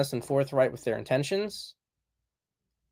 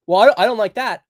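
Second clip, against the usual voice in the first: higher, louder mock complaint; protesting objector; 7 semitones higher, 8 dB louder, faster than usual. Woman objecting to a man's blunt words, mock protest. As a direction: push back with mock displeasure.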